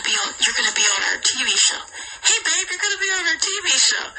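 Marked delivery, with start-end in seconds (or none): 2.2-4.1 higher, brighter call to babe; higher eager hey babe; excited call. Retold or imitated. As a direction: sell it with excitement. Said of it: "He's like".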